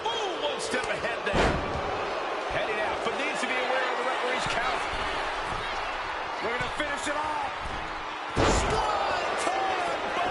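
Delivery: fast, rising play-by-play call; wrestling commentator. Acted. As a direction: build hype up to the slam. Play-by-play calling the match.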